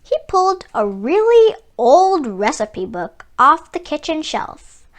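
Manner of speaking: plain reading voice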